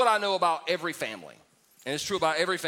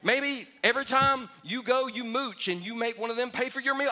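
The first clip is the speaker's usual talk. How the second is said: exasperated higher retort; 8 semitones higher than usual. Retold or imitated. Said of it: "I'm like".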